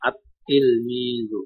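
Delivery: আত-তিলমিজু very slowly, L dropped, T doubled; teacher modelling the correct আত-তিলমিজু; right way, doubled t. Shown as a demonstration. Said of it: "তার উপরে তাসদিদ হবে।".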